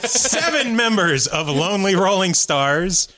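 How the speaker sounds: normal talking voice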